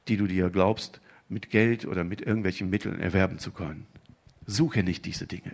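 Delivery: put-on like a gentle old sage; wise old man voice. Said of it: "als weiser alter Mann".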